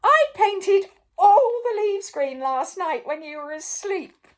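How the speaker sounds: high, loud piping voice; Mister Silly confessing to the king. A narrator's reading. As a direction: pipe up eagerly. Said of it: "piped up Mister Silly".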